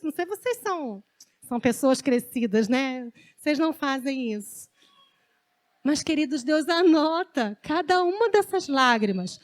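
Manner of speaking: normal talking voice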